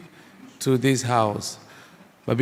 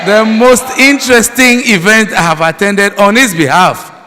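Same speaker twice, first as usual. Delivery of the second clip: louder and higher, overflowing with joy; carried away by delight at the event; real excitement; 6 semitones higher, 8 dB louder than usual. Real, not acted. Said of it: "am very excited, very happy".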